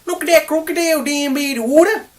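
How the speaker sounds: loud, high mock Australian accent; hammed-up British attempt at an Australian accent; fake Australian accent. Reviewer mocking Chuck's accent, exaggerated fake Australian. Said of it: "sounds like a British guy putting on an Australian accent".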